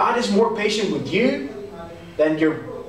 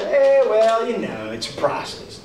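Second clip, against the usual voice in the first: acted couldn't-care-less brush-off; mock shrugging excuse; 4 semitones higher than usual. Mimics someone using process as excuse.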